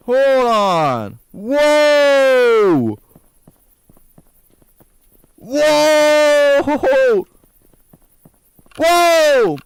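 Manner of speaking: long high shouted whoa; drawn-out alarmed cry, high and loud; loud startled whoa. Real, not acted. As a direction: yell out in shock at the scene. Startled by the house decorations.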